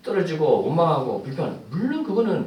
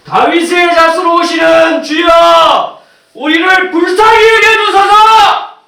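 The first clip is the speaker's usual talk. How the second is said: drawn-out, high full-voiced shout to the Lord; crying out for mercy; 12 semitones higher, 16 dB louder, slower than usual. Blind man calls to passing Jesus, crying out for mercy. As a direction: cry out slowly and loudly, holding the words. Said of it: "더 소리를 질러요".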